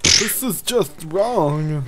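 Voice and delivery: milder hammed-up whine; mock whine. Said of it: "Quit whining about".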